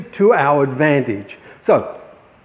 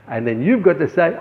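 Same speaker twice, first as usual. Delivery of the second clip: ordinary delivery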